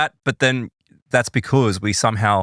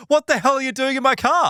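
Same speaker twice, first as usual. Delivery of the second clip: startled; 12 semitones higher than usual. Retold or imitated. Startled at a stranger in his car.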